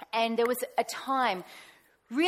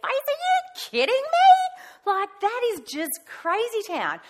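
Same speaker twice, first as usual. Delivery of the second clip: much higher, slow, loud disbelief; incredulous; 13 semitones higher, 8 dB louder, slower than usual. Retold or imitated. Outraged at people's cruel words, high incredulous squeal. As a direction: squeal it like you can't believe anyone would be that rude.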